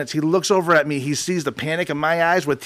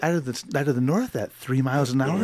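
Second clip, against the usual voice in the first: slow, softer smooth DJ announcement; dad on air, imitated as a mellow radio host; imitating a radio DJ voice; 5 dB quieter, slower than usual. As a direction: lay it on like a late-night jazz host. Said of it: "first introduction to radio voice".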